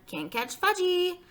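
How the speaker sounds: high, slow singsong taunt; drawn-out small-child holler; hollering toddler. A narrator's reading. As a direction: tease like a runaway tot. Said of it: "he hollered".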